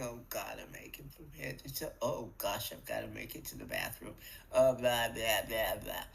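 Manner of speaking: low, dragging, miserable morning moan; weary groaning. Shown as a demonstration. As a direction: sound like you can barely get up. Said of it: "You can tell what you're putting in your day by how it sounded".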